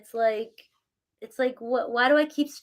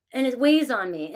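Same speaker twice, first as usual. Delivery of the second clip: ordinary talk, nothing marked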